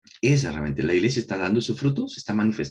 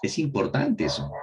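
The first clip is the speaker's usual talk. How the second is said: ordinary delivery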